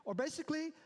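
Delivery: ordinary talk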